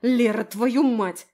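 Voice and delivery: loud, slow exasperated swearing; hissed curse. A narrator's reading. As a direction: curse her through gritted teeth. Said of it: "прошипела сквозь зубы".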